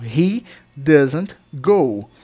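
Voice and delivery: very slow, each word set apart for students; slow teacher demo of a sentence; slow model sentence. Shown as a demonstration. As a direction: spell it out slowly so the class can repeat. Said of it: "teacher models the sentence".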